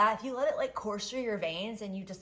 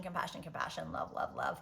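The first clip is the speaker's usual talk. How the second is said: whispered mantra; 4 semitones lower, 7 dB quieter than usual. Pretend. Softening rage into compassion.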